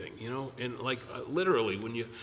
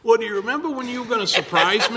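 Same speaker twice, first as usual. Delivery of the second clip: higher, louder, laughing through it; laughing quote; 9 semitones higher, 8 dB louder, faster than usual. Retold or imitated. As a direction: tease him with a laugh. Retold confrontation, laughing.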